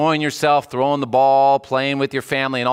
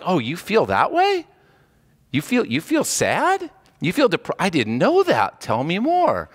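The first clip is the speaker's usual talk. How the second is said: mock surprise; 5 semitones higher than usual. Mock God feigning surprise.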